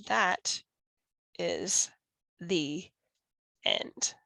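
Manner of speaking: slow, fading, each word apart; soft ending. A narrator's reading. Narrator ending story for children, soft and slow. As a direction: let the story fade out word by word. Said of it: "closing the story".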